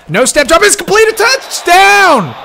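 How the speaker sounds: announcer erupting on the game-tying drive's touchdown; touchdown call. Real, not acted. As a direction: scream it as the ball is caught. Calling the touchdown.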